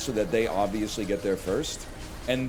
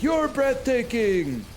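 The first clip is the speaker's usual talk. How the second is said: much higher, slow, drawn-out cry; mock gushing; 13 semitones higher, 5 dB louder, much slower than usual. Streamer hamming up praise to chat, gushing. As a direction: gush it theatrically.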